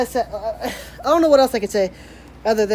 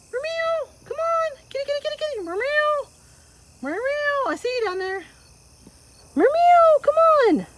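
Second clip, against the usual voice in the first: very high meowing call; calling the cat; slower than usual. Real, not acted. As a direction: coax the cat in with high meows.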